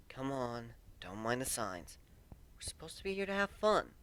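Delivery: whispered, soothing reassurance; hushed coaxing. A narrator's reading. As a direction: urge her along under your breath. Coaxing past signs, whispered.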